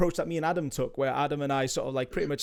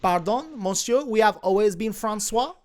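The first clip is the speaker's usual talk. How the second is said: put-on higher, proudly French accent; 5 semitones higher, 4 dB louder than usual. Crew member insisting on being French, French accent. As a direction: play up the French accent with conviction. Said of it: "you definitely have a French accent now".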